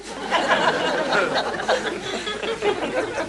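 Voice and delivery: high, helpless burst of laughter. Real, not acted. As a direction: laugh out at the dropped dart. Contestant dropped his dart early.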